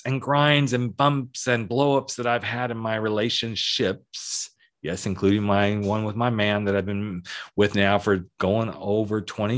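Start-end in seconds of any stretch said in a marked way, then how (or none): none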